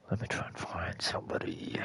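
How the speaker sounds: whispered to himself, barely voiced. Real, not acted. Streamer whispering to himself while searching the player list. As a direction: breathe it out under the breath. Repeats phrase, then whispers it.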